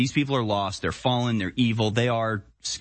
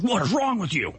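mock angry yell; 7 semitones higher than usual. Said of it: "He's just like".

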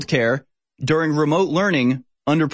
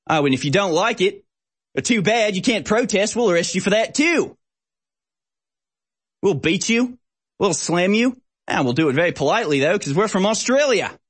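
higher mock Australian cop voice; mock Australian police; 6 semitones higher than usual. Host parodying Victoria Police, silly Australian accent. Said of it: "in a silly accent".

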